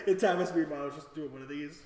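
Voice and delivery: normal talking voice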